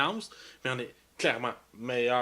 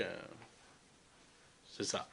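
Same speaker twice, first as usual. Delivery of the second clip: trailing off; 8 semitones lower, 16 dB quieter than usual. Real, not acted. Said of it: "J'ai oublié ce que j'allais dire".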